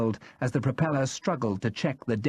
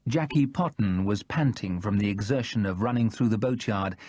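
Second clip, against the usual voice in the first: plain reading voice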